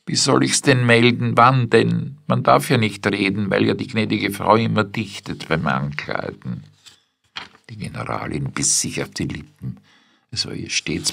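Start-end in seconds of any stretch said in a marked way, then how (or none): 0.0-6.6 maid wails, higher and louder; higher, louder whining maid; whining complaint. A narrator's reading. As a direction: complain like a wronged servant. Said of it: "und Adele jammerte".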